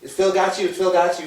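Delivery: high, loud mock fussing; fussing family. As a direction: pile on the worried questions. Imitating worried family calling.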